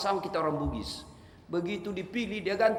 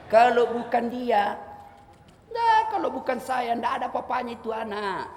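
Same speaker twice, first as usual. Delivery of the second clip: boastful braggart; 2 semitones higher than usual. Shown as a demonstration. A boastful man brags about raising an orphan. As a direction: brag to a friend about your good deeds.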